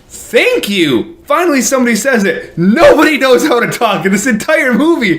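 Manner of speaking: high-pitched, emphatic venting; exasperated rant. Retold or imitated. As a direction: rant in worked-up, mock-relieved exasperation. Exasperated rant about riddling dialogue.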